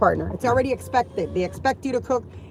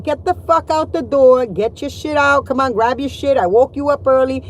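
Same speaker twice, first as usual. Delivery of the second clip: retold yelling at kids; 3 semitones higher, 6 dB louder than usual. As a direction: bark orders at the kids to get out the door. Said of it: "I'm yelling my brains out".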